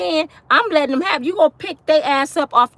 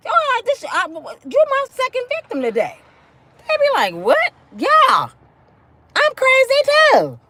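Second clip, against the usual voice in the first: a hammed-up madwoman shouting; playing crazy; 10 semitones higher than usual. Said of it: "Meet their crazy".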